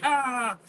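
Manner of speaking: high mock scream of pain; retold scream. Teen bitten by rat, high retold scream. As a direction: yelp as the rat hangs off the finger. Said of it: "I'm like".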